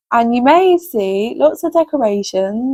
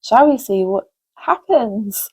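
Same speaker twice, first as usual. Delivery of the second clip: usual speaking voice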